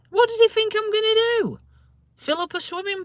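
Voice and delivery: high, loud, indignant question; exasperated. Real, not acted. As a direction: pitch right up in real exasperation. Exasperated rant about Dad.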